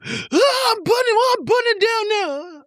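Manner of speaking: put-on very high whiny kid voice; wailing child. Child giving in to dad over the game, high wail. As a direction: wail it out like an upset little kid.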